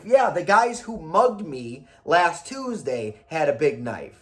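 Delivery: normal talking voice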